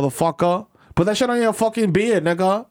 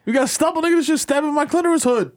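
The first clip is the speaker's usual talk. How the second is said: much higher pained outburst; higher hammed-up complaint; mock pained shriek; 8 semitones higher than usual. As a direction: yelp like a scratched woman. S1 voices woman in pain.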